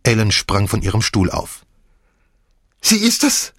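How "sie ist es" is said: shrieking in terror. A narrator's reading. Maid seeing a ghost, shrieking in terror. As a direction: shriek it as if you'd seen a ghost. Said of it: "kreischte sie".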